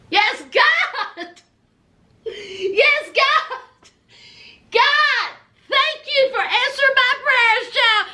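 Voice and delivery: high, jubilant mock praise; Kim. Woman thanking God for the answered prayer, hammed-up rejoicing. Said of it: "Here's Kim".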